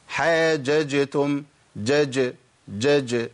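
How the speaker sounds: حاججتم slow, then جج bounced twice; correct qalqala. Shown as a demonstration. Teacher correcting by slow demonstration. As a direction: bounce the sakin jeem in جج lightly. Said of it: "لا يا أخي".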